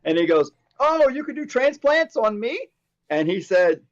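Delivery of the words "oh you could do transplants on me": pitched way up, eager question; hopeful surprise. Retold or imitated. Bald neighbor asking Max about transplants, high and eager. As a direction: voice jumps high as if hope just appeared.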